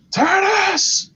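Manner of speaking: high, far put-on voice barking the first word; imitating a furious lord demanding the key; mock Viktor demand. Lord demanding the collar key from Tanis, high put-on shout.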